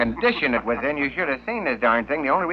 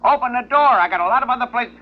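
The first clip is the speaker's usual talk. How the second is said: higher, louder, losing patience at the door; exasperated plumber; 10 semitones higher, 5 dB louder than usual. Acted. Plumber shouting at the parrot behind the door, exasperated and raised. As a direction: yell through the door, fed up. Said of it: "this gets more excitable".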